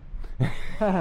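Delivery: bursts into a high laugh. Real, not acted. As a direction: let out a big high laugh. Laughing at his own joke.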